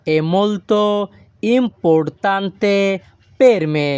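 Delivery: very slow, careful Italian sentence; slow Italian model. Shown as a demonstration. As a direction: drag importante out for learners. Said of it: "এই বাক্যের Italian ভাষা হবে".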